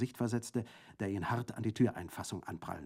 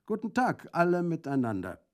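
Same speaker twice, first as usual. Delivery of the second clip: higher, louder called greeting; calling out; 7 semitones higher, 5 dB louder than usual. A narrator's reading. Bandit greeting everyone in the room, calling out. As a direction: call a greeting to the whole room. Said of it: "rief Brandolaccio".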